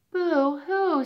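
high pretend boo-hoo; hammed-up sob; mock crying. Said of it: "said the deer".

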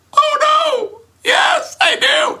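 very high, shouted panicky squeak; panicked yelp. Retold or imitated. Caught student answering the girl's father, high panicked shout.

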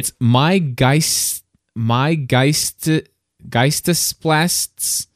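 slow, halting "Geist" then "Geistesplasts" sounded out; unsure German word. Real, not acted. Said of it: "I have no idea how to say this thing".

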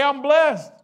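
high, loud, emphatic shout; said like you mean it. Shown as a demonstration. Preacher demands the crowd say it like they mean it, loud. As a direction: shout it with conviction. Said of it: "say it like you mean it".

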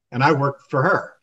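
ordinary talk, nothing marked

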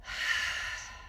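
breathy demo sigh; audible exhale. Shown as a demonstration. Showing listeners the noise of the exhale, soft demo sigh. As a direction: let the breath out audibly as an example. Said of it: "the noise on the exhale".

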